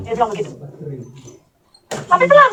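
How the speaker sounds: ordinary talk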